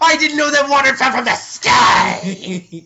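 loud, high, slow sarcasm cracking into laughter; hammed-up sarcastic shout; mock sarcastic wail. Repeats quote demonically.

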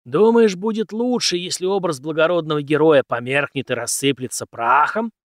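loud rhetorical challenge, starting high; emphatic, lecturing question; challenging. A narrator's reading. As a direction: challenge the pupil forcefully. Teacher challenges his pupil.

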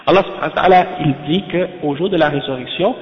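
ordinary delivery

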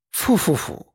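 soft disgusted fu-fu-fu. A narrator's reading. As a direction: recoil in disgust. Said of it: "морщась ещё сильнее".

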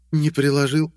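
high female voice; young woman voice. Acted. Tasha replies.

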